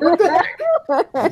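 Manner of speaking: put-on very high, drawn-out kid protest; hammed-up little child, stretching the words; retold child's voice. Granddaughter's only answer to grandpa, hammed-up child voice.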